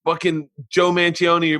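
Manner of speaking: usual speaking voice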